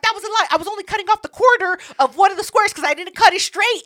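high, fast excuse-making. Retold or imitated. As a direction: talk your way out of it.

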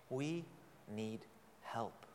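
quiet pleading emphasis; hushed plea. Real, not acted. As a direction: drop to a near hush to land the point. Sermon climax, dropping to hush.

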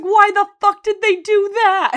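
furious mock Frank shouting; exploding rant. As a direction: blow up at the news. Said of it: "he just explodes".

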